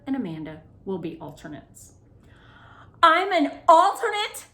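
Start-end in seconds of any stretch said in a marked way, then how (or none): 3.0-4.5 high, loud disbelieving cry; shocked gasp. A narrator's reading. Claire hearing she's only an alternate, shocked and loud. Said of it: "Claire gasped".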